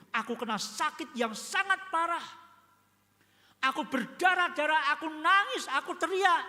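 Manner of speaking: imitating a believer telling of sickness; mock testimony. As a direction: ham up a witness recounting pain.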